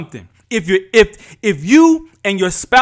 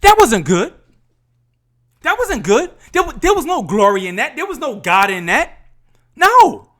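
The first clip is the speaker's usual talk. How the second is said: worked up, high and emphatic; real emotion bursting out, pitch jumping up; getting emotional; 4 semitones higher, 4 dB louder than usual. Real, not acted. Podcaster condemning what his kids had to see, emotional. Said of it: "I'm getting emotional".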